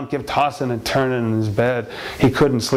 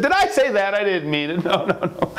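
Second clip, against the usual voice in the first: high-pitched protest breaking into a laugh; hammed-up innocent backpedalling; breezy denial; 9 semitones higher than usual. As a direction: brush it off giddily, pitched up high. Husband denies hating the Guggenheims.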